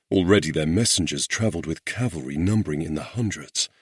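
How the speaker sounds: usual speaking voice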